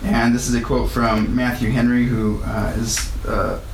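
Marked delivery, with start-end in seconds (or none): none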